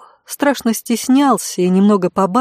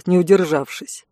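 ordinary delivery

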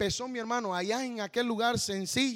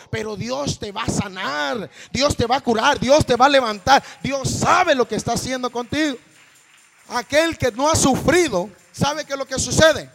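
loud, soaring sermon cries; preaching at full force; 3 semitones higher, 11 dB louder than usual. Acted. Preacher promising the sick that God heals, full-force preaching. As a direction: lift the whole church with it.

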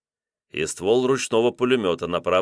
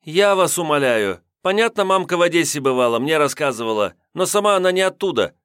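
put-on sassy young woman; 8 semitones higher, 4 dB louder than usual. A narrator's reading. Redhead voiced, high.

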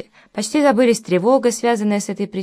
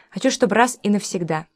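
ordinary delivery

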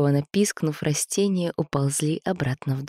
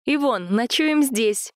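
slow, high call across the camp; 8 semitones higher, slower than usual. Acted. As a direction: call it out to him. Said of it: "крикнула Ольга".

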